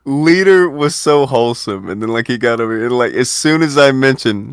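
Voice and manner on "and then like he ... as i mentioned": ordinary talk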